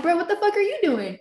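incredulous. Retold or imitated. Retold shock at friend.

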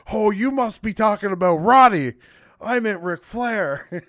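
loud, high-pitched mock cover-up; Mean Gene. Mean Gene saves an awkward moment, loud hammed-up blurt. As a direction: blurt it out high and big, stretching the end into a laugh. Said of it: "'cause he's like".

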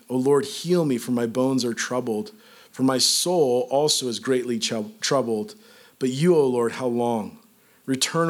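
low-pitched, measured psalm reading; scripture reading. Acted. Preacher reads David's plea to congregation, measured scripture reading. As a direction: read the lament aloud with solemn restraint.

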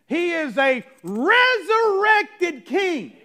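high, loud, slow exultant cry; fervent proclamation. Real, not acted. Preacher crying out to the church about the king. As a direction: proclaim it like a victory shout.

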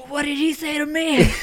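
mock outrage. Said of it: "That's about as bad as I freak out".

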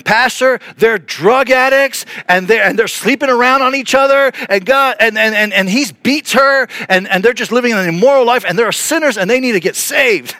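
high, loud, unrestrained imitation; bold, blunt denouncing. Bold convert introducing a couple to her pastor, loud and blunt. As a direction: blurt out their sins at full volume. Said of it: "very bold. She, uh, she brought a couple".

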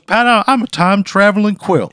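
high, loud blustery cartoon rooster voice; Foghorn Leghorn. Scene: the time-travelling quilt introduces itself to Pat, in a blustery cartoon rooster voice. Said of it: "sounds like Foghorn Leghorn".

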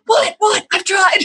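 high, pleading "what, what"; mock whiny teen. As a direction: protest like a flustered boy. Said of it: "and he's like".

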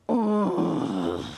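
low, drawn-out acted groan; earth groaning. Preacher acting out the suffering earth, low groan.

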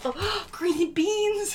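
gasping realization, laughing; shocked laugh on green beans; surprised gasp. Real, not acted. Discovering the label.

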